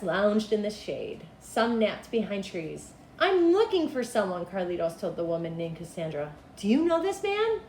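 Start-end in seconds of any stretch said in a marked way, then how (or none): 3.2-4.5 put-on high polite child; little boy's voice. Little boy approaching the ice vendor, high and polite. 6.6-7.6 put-on high polite child; little boy's voice. Little boy approaching the ice vendor, high and polite.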